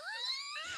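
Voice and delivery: sudden high-pitched squeal of laughter. Real, not acted. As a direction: squeal with laughter at your own joke. Laughs at own joke.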